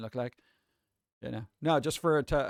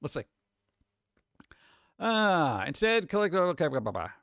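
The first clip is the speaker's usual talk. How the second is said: ordinary delivery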